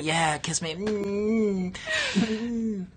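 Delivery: high, soft smooching voice; pretend kissing Predator scene. Host mimics a kiss with a Predator, hammed-up smooching. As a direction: coo it and hum into a mock kiss. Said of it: "hand puppet kiss simulators".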